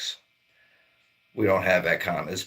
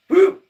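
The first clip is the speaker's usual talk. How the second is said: yelp; 11 dB louder than usual. Pretend. Mock yelp at the cold.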